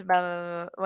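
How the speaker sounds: soft mumbled blah blah; quiet mumbling demo. Shown as a demonstration. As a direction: mumble it under your breath. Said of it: "when someone talks like this".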